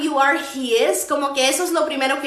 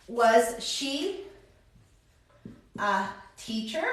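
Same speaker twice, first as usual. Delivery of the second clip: very slow, long pauses, softer; slow dictation; 6 dB quieter, slower than usual. Shown as a demonstration. Teacher writes out the English question, slow and halting. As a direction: break it up softly, pausing before "a teacher".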